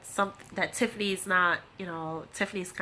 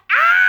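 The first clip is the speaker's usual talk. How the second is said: short high scream, loud; sudden shriek of shock; 12 dB louder than usual. Retold or imitated. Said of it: "And she starts screaming".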